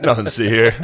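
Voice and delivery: amused fake cop brush-off; laughing mock cop. Friend mocking a police cover-up, laughing mock cop. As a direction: chuckle it like a cop waving people on.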